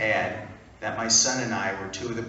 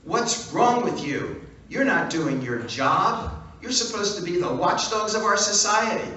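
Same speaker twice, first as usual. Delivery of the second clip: angry scream; 7 semitones higher, 3 dB louder than usual. Retold or imitated. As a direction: tear into the reporter, furious. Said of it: "I began to scream at him".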